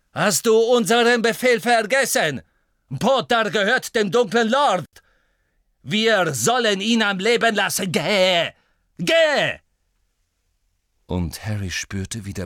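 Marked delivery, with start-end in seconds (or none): 0.1-9.6 loud, high commanding shouts; shouted orders. Acted. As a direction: order the Death Eater off. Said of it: "rief Snape". (laut befehlend rufen)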